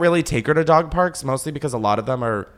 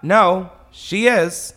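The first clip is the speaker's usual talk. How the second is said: forced polite; 7 semitones higher, 5 dB louder, much slower than usual. Retold or imitated. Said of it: "I was like".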